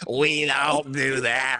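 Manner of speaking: a gravelly smoker voice, slowed down; rough smoker impression. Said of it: "Sherman does not sound like that".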